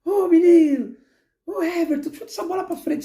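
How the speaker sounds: high-pitched mock coach yells from the touchline; shouting coach. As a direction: yell orders at players in a strained high voice. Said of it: "Você não vê o Abel gritando".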